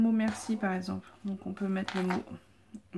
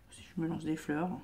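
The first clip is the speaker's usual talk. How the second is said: whispering; 4 semitones lower, 6 dB quieter than usual. Real, not acted. Self-mocking aside.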